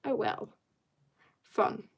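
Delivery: usual speaking voice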